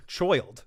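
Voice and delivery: put-on "child" said as "choild"; mispronounced "child". Shown as a demonstration. Streamer mocks own slip, "child" as "choild". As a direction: repeat "child" with an exaggerated oy vowel. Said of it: "it came out as child".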